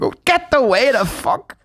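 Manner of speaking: gruff mum brush-off. Retold or imitated. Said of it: "And she's like".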